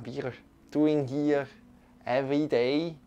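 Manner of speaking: ordinary talk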